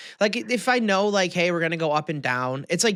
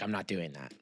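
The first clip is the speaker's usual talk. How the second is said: low, soft, final no; scared quiet refusal; flat refusal; 9 semitones lower, 13 dB quieter than usual. Pretend. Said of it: "lean back and be like".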